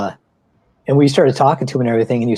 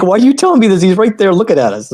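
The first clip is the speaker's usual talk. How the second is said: exasperated; 7 semitones higher, 3 dB louder than usual. Retold or imitated. Retelling his startled reply to wife.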